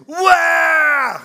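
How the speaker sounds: high loud mock crying wail; imitated toddler wail. Grandfather imitating grandson's wail, loud mock cry. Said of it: "And he goes".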